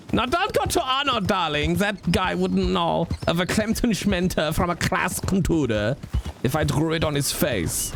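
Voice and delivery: a hammy aristocrat looking down his nose; posh character voice. Pretend.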